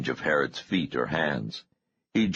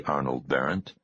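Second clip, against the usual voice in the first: usual speaking voice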